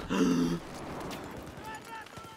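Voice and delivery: long, strained gasp; gasp, cut off by sudden death; startled gasp. Real, not acted. Killed suddenly in game.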